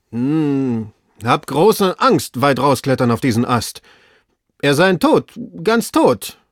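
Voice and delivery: slow anxious hum, then wail it down; fearful servant. Acted. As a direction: servant reluctant to climb further. Frightened servant up in tree.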